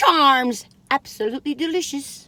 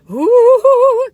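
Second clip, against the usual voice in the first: excited ooh; 11 semitones higher, 13 dB louder than usual. Real, not acted. Excited at the cookie box.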